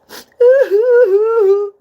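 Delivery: loud, high put-on wail; mock sobbing, high and loud; played-up sob. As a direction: ham up a sudden crying fit. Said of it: "I just had to play on that part".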